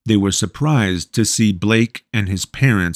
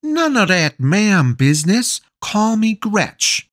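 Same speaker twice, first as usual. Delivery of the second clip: cheerful mother; 12 semitones higher than usual. A narrator's reading. Voices the mother Gretchen.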